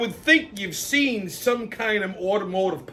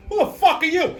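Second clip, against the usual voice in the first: high startled yell at an intruder; startled shout; 11 semitones higher, 3 dB louder than usual. Retold or imitated. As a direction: jolt awake and demand it, pitch up. Wakes to stranger in bedroom.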